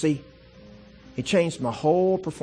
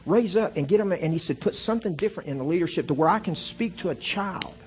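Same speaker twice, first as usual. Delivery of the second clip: full-force preaching; 5 semitones higher than usual. Acted. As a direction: preach it out to the whole room. Preaches God's words at full force.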